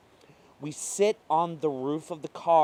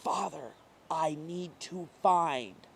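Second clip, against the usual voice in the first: slow, rasped and strained; injured girl rasps to the worried woman; raspy voice; 4 semitones higher, slower than usual. Acted. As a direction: push the words through a sore throat. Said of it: "with a raspy voice".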